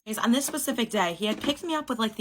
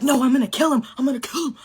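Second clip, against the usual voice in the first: mock angry guy; 5 semitones higher, 6 dB louder than usual. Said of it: "He's like".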